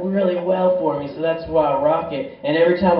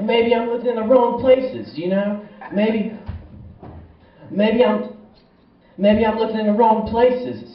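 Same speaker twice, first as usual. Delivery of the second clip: higher musing self-quote; 7 semitones higher than usual. Retold or imitated.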